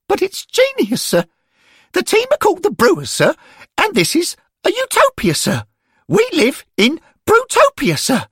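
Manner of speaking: put-on a keen young lad pleading with his elders; schoolboy Derek. A narrator's reading. Schoolboy Derek defending his name to the angels, high and eager.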